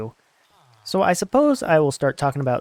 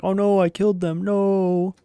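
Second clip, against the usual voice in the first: mock dismay; 6 semitones higher than usual. Boy finding his mom and sister gone, hammed-up dismay. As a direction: play up the boy's horror, voice raised in pitch.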